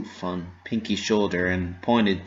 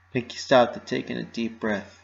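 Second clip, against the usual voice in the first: usual speaking voice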